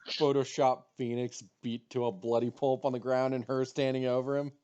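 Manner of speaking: normal talking voice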